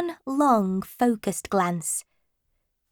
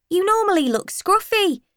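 cheeky schoolboy; 11 semitones higher than usual. Acted. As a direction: go for the laugh. Another boy teasing.

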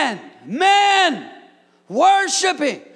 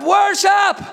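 higher, louder one-word cry "Worship"; shouted answer; 8 semitones higher, 4 dB louder than usual. Real, not acted. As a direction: fire it back at them. Pastor's one-word answer to complaints.

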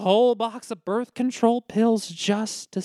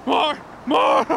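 deranged shout; 7 semitones higher than usual. Acted. Madman rant about eyes.

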